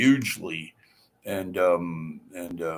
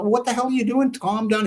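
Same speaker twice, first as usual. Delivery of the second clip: much higher, alarmed mock protest; retold alarmed outburst; 12 semitones higher, faster than usual. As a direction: yelp it like you're watching your tree get cut. Shocked at friend cutting tree.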